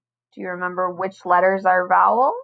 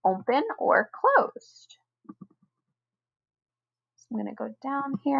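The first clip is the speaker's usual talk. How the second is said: whisper; 5 semitones higher, 6 dB quieter than usual. Real, not acted. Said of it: "whispered aside in lesson".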